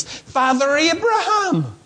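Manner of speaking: a hint of a whiny entitled man; high, slow, self-entitled plea; self-entitled rich man. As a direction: draw out the address, high and slow. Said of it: "This self-entitled man begins".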